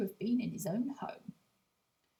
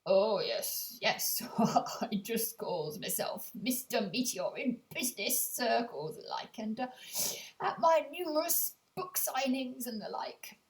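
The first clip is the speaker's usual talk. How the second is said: George Bluffer Humble. Acted. Castaway replying to the radio host, folksy self-important voice. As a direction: answer like a pompous, chuffed local celebrity.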